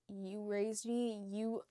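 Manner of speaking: slow, sincere thanks; heartfelt. Real, not acted. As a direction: say it slowly, meaning it. Heartfelt message to Tana.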